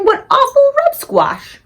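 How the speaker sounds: very high, slow mock-posh scoff; scoffing Queen. BFG mimics the Queen scoffing, very high and slow. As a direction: sniff at the tall story. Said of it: "the Queen will be laughing and saying".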